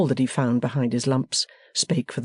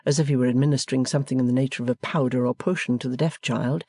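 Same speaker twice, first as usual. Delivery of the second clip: ordinary delivery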